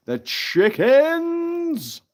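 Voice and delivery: high, loud, drawn-out squawk of a word; hammed-up announcement. Streamer naming the fox video's chickens, high drawn-out cry. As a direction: stretch it out high and silly.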